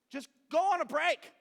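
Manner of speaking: venting at people announcing a social media break; exasperated. Acted. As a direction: throw it up in disbelief. Exasperated rant at posters.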